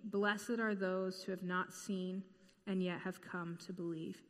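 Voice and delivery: soft, measured Bible reading; scripture reading. Acted. Preacher reading scripture to the congregation, soft and measured. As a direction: read the verses quietly and reverently.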